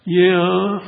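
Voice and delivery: caught-out reply to the man upstairs; sheepish yeah. Retold or imitated. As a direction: answer up, caught in the act.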